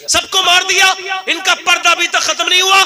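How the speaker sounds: loud jeering yell, pitched high; a jeering soldier mocks the grieving women; mocking shout. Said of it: "हम मजाक करने लगे".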